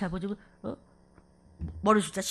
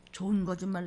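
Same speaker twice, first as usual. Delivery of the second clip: usual speaking voice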